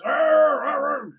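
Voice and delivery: loud, high muffled yelling; gagged cries. Acted. Game master as bound woman before changing shape, gagged cries. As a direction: strain to yell through a gag. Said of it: "after being gagged by all that, goes".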